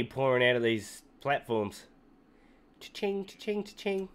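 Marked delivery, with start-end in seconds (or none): 2.8-4.1 cash register. Pretend. Money pouring out, cash sound.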